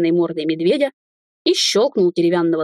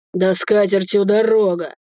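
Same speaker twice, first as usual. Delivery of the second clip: put-on drunken boss's restrained outcry; mild shout. A narrator's reading. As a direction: call it out dismissively, without full volume. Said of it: "выкрикнул хозяин отеля". (несильный выкрик)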